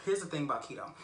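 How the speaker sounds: ordinary delivery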